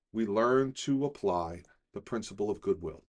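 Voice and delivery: normal talking voice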